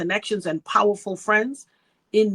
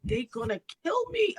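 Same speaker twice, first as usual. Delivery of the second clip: retold dread, pitched higher; mortified; 6 semitones higher, 4 dB quieter than usual. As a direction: imitate yourself pleading in horror. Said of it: "I was mortified".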